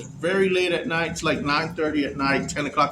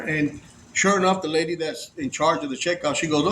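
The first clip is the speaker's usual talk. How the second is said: normal talking voice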